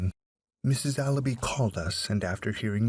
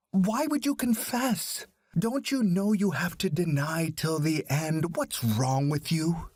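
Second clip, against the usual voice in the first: angry father; 6 semitones higher than usual. A narrator's reading. Father scolding his son for confessing, angry and high. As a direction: berate the son, pitch raised high. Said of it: "asked his son in anger".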